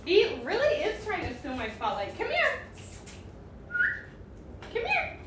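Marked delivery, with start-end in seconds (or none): 2.2-5.2 calling the dog sweetly, high up; high coaxing dog call. Real, not acted. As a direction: coax the dog over in a high voice.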